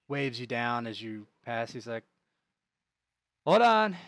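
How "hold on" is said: loud, raised guard's halt; calling out. Acted. Guard stopping a passing wagon, calling out. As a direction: call out higher and much louder to stop them.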